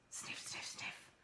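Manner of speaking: breathy dog sniffs; whispered sniffing. Acted. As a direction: sniff softly under your breath.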